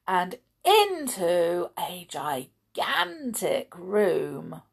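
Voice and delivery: plain reading voice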